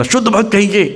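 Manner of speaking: high, sharp call that sounds like a scolding; high-pitched praise. Retold or imitated. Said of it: "क्योंकि pitch high था".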